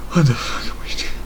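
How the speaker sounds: quick, voiceless wheeze through laughter; helpless laughter. Real, not acted. Reader questioning the absurd story, wheezing with laughter. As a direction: squeeze the words out while dying laughing.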